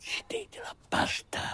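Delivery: awed whisper. Acted. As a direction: breathe it in wonder. Awed comment about the pasta.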